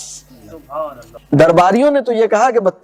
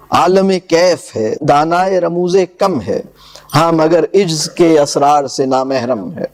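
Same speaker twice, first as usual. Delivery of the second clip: measured, lower verse recital; poem recitation; 4 semitones lower than usual. Acted. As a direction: recite the couplets with gravity. (شاعرانہ انداز میں پڑھت)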